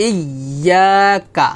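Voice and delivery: very slow "ইয়াকা", long "ইয়া", short "কা"; recited "ইয়াকা", stretched then cut short on "কা"; palatal held ইয়া. Shown as a demonstration. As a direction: press and hold the y in "ইয়াকা". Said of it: "ইয়া উচ্চারণটা হবে".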